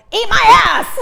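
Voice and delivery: sudden high shriek of a yell. Retold or imitated. Yelling at a man who nearly ran into her. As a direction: blurt it out at the top of your voice. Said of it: "And I yelled".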